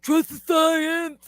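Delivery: slow, high put-on mocking voice; mocking silly voice. Mocking science believers in her silly TikTok voice. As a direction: drawl it out as a goofy caricature. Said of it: "because I used that voice".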